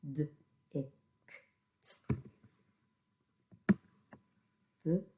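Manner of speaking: D-ict said softly, split into sounds; teacher segments quietly; sounding out phonemes. Shown as a demonstration. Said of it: "Pinch those phonemes as you say them".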